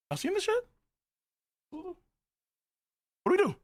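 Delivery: tiny, very high falsetto; mock-alarmed high little voice; panicked squeak. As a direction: squeak it softly like a frightened bystander. Acts out bewildered onlookers.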